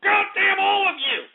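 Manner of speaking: real furious shouting, pitched high; wściekły krzyk. Real, not acted. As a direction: scream in outrage at the people who wronged you. Furious rant at prank callers.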